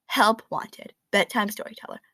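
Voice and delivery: plain reading voice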